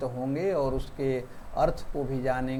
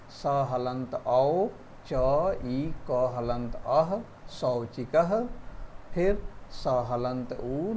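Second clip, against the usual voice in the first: slow letter spelling; slower than usual. Shown as a demonstration. Teacher slowly spells out letters so students can join them. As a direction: spell out each sound slowly and deliberately, one at a time. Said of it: "इसको मिला करके आपको एक शब्द बनाना है".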